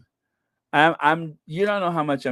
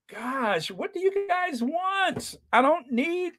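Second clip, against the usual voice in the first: high-pitched, softer whine of complaint; mock exasperation; 12 semitones higher, 4 dB quieter than usual. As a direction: play at being pestered by spammers. Mock complaint at spam comments.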